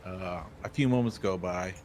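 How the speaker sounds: normal talking voice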